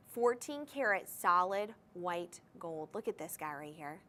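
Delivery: ordinary talk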